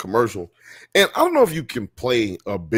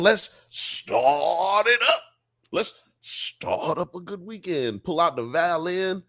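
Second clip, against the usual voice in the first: slow, higher, savoring each word; a lightly voiced housewife relishing her morning; mock dreamy housewife; 5 semitones higher, slower than usual. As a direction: act out a woman easing into her weekend. Acting out her weekend ritual.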